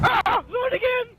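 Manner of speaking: high panicked shout; frightened, spotting it again; scared yelp. Real, not acted. Monster appears again.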